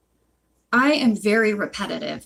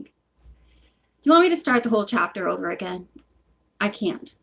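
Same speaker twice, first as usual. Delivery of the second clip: ordinary delivery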